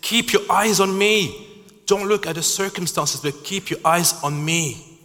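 urgent preacher's voice of Jesus; voicing Jesus. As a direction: cry it out as Jesus pleading. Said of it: "And Jesus is saying".

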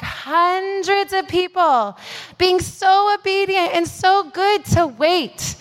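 ordinary talk

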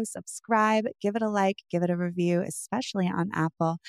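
ordinary talk, nothing marked

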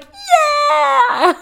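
high squealed crowd Yeah; mock cheer. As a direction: cheer like a thrilled audience, sarcastically. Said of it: "Everyone's like".

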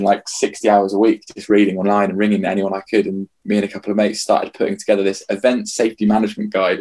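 normal talking voice

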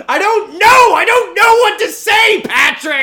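very loud, high-pitched cry for help; panicked shouting. Real, not acted. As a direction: yell helplessly for your missing co-host. Flustered host shouts for absent co-host.

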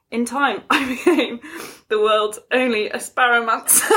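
barely holding back laughter; real corpsing. Real, not acted. Reading out the article's absurd title, giggling. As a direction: get the line out before cracking up.

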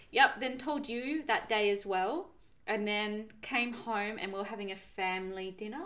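ordinary delivery

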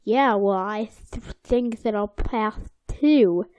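usual speaking voice

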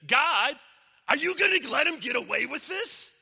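acted outraged shout, high and loud; indignant angel. Angel challenging God over the golfing preacher, indignant shout. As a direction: cry out in hammed-up disbelief.

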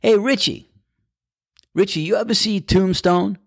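squeaky mimicry of a friend; imitating Cloudy. Golfer mimics caddie Cloudy in a high voice. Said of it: "He goes".

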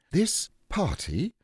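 put-on high, slow boyish announcement; a child opening a speech; Christopher Robin. A narrator's reading. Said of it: "said Christopher Robin".

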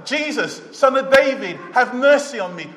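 loud, high plea to Jesus. Acted. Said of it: "He said again a bit louder".

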